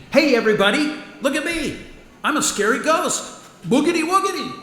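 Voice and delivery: high, loud mock ghost wail; hammed-up spooky ghost. Ghost Paul clowning to the diner crowd, hammed-up spooky. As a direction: goof around as a silly scary ghost.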